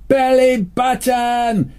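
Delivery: high, loud, drawn-out yell; retold shout. Rider at the cliff edge yelling the stop word to his horse, high drawn-out shout. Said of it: "And he shouts out".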